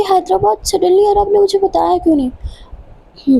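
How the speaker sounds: high-pitched, startled disbelief; narrated dismay, voice jumping up; shocked protest. A narrator's reading. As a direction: protest at the sudden news in a high voice. Sudden news of Hyderabad trip.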